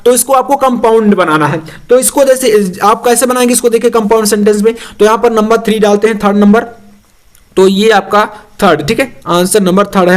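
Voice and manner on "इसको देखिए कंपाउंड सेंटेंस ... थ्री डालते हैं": ordinary talk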